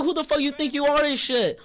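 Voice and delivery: indignant high-pitched comeback, not shouted; retold angry outburst. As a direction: replay the argument with pitch pushed up. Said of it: "I'm getting loud again".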